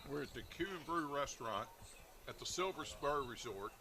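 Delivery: normal talking voice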